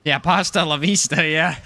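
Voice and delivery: complaining about spaghetti in a fake Italian accent; a hammed-up Italian grumble; lightly done Italian accent, half joking; mock Italian accent. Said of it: "It's supposed to be Italian".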